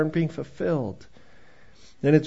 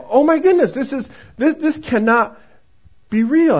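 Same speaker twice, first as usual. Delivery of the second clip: much higher, breathless with a laugh; disbelieving joy; 8 semitones higher, 6 dB louder than usual. Retold or imitated. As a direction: gasp it out in happy shock. She can't believe it.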